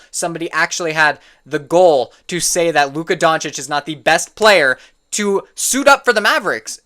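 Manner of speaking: ordinary talk, nothing marked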